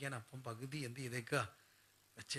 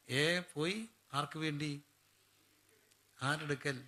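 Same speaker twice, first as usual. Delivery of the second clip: normal talking voice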